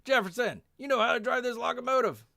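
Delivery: high, loud shouted order; bellowed call to a subordinate; yelling out. Acted. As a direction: yell it down the line like a major. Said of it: "Major Dice yells out".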